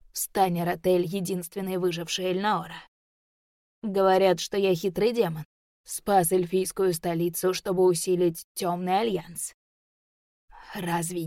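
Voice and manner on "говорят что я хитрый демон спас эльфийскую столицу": ordinary delivery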